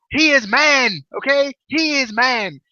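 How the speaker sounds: high, hammed-up Indian accent; terrible accent. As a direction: proclaim it in a bad Indian accent. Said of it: "quit with this terrible accent".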